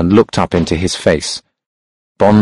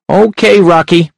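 high, sharp and loud; a sharp, high-pitched hunchback; 10 semitones higher, 4 dB louder than usual. A narrator's reading. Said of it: "The hunchback's voice was sharp and high".

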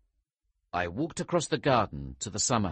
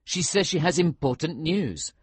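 raised voice pressing the case; arguing; 7 semitones higher, 5 dB louder than usual. A narrator's reading. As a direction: argue back, higher and louder. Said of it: "I argued".

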